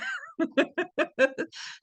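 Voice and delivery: long bright real laugh. Real, not acted. Amused by the question about his accent, a long real laugh. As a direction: burst out laughing at the remark.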